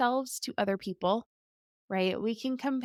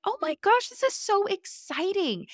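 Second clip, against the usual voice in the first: excited gush; 10 semitones higher than usual. Retold or imitated. Mom thrilled by her daughter's new stage, high excited gush. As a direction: squeal it like great news just landed.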